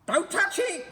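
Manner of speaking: put-on very high, loud, sneering old woman; cackling nasty wife; Missus Twit voice. A narrator's reading. Missus Twit sneering at the monkeys, shrill and loud. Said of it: "cried Missus Twit".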